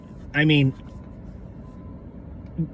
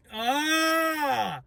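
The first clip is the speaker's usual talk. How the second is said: strained yell; 10 semitones higher, 5 dB quieter than usual. Real, not acted.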